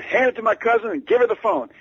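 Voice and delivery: high, loud, brusque demand; high-pitched, loud impersonation of a famous singer on the phone. Retold or imitated. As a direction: bark it down the phone like a star. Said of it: "shouting so loudly".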